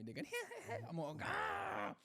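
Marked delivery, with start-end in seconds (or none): 0.3-0.9 high mocking giggle; high imitated giggle; imitated nurses' giggle. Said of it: "they're all giggling at me". 1.2-1.9 high pained yelp; high retold pain cry. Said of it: "in pain and that".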